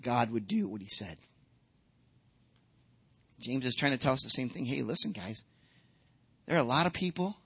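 usual speaking voice

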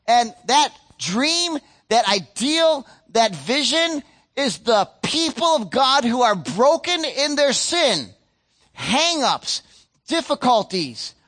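slow, swelling sermon delivery; fervent pulpit voice; impassioned preaching. Acted. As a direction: stretch each phrase out to the congregation. Preaching at full force.

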